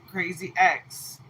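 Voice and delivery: usual speaking voice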